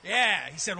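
normal talking voice

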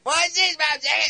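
put-on high, slurred drunk pirate-like voice; drunk stuntman voice. Drunk stuntman talks to the camera in a high slurred voice. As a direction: play the drunk guy pitching his stunt, way up high. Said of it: "very funny to hear".

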